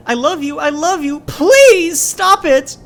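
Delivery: very high and very loud, stretched slow; desperate screaming plea. Acted. Child pleading with father holding rifle, screaming. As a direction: beg him with everything you have.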